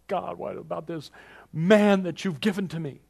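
high, whiny moaning to God; whining complaint. Retold or imitated. Preacher mocking a whiner complaining to God. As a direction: whine like a self-pitying believer. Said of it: "not as something to whine about".

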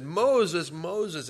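God calling from afar, high-pitched. Acted. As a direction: call the name out loud, then ease off. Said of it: "God called to him".

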